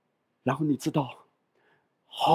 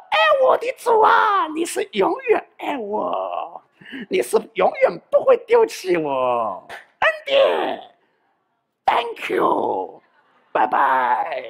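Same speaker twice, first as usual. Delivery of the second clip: high, tearful-sounding wheedling, then a curt bye; butter God up, then dash off; mock pleading prayer; 12 semitones higher, slower than usual. Preacher mocking a believer who prays only in trouble.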